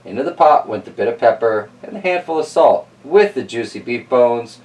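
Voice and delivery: usual speaking voice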